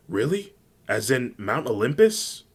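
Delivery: perky character voice, taken aback; surprised question. A narrator's reading. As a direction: ask it high and amazed. Uraraka surprised by the news.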